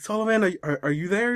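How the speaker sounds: high, half-hearted mock call. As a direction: call for a friend without raising volume. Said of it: "he starts calling Sullivan".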